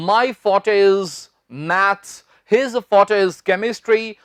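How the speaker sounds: very drawn-out examples; slow example lines. Shown as a demonstration. Teacher modelling sentences for spoken English. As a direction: speak the examples slowly. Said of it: "कह सकते हैं".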